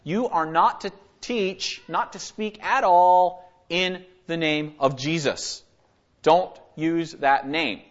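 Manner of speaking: slow, deliberate stern order; strict command. Acted. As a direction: lay down the prohibition slowly, word by word. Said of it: "they command them strictly".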